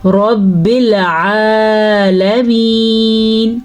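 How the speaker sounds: رب with a full, heavy ر, very slow. Shown as a demonstration. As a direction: make the ر of رب thick and full. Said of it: "را کو پر یعنی کہ موٹا پڑھیں گے". (رب کی را کو پُر یعنی موٹا پڑھیں)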